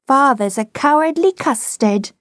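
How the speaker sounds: high, sing-out playground taunt; taunting child. A narrator's reading. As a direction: tease father in a high child's voice. Small boy taunts his father.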